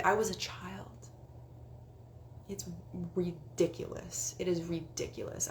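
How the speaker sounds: ordinary talk, nothing marked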